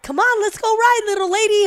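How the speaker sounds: high, bright mock-cheery invitation; chirpy invite. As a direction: sing it out like a jolly coach. Quoting S0's cheerful invite.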